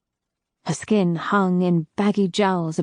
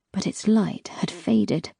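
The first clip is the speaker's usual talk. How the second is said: usual speaking voice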